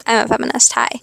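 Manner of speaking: ordinary delivery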